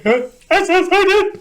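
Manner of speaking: very high, slow tearful stammer; mock crying. New Marine answering his kill hat, mock tearful squeak. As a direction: blubber it out, exaggerated. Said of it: "in part what made me cry".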